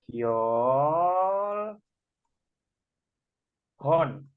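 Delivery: "Kyol" held long and rising, then "Hon"; syllable-by-syllable reading. Shown as a demonstration. Teacher reads out the written word, syllable by syllable. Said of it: "tulisannya begini ya".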